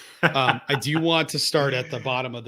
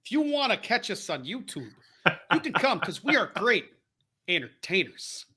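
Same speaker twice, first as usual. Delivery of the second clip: higher-pitched mock promo, halting before the last word; mimicking a wrestler; 4 semitones higher than usual. Co-host plugging the YouTube channel as the wrestler, in mock promo voice. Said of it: "He's got that very s-, long pause".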